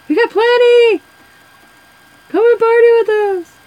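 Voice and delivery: hammed-up invite from drunk college fans; partying tailgaters. Imitating tailgating kids inviting her.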